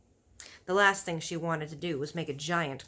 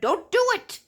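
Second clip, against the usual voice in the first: scolding giant; 8 semitones higher, 6 dB louder than usual. A narrator's reading. Giant keeps scolding loudly.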